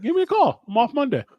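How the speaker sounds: high, breezy friend offering help; imitating his friend. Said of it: "He's like".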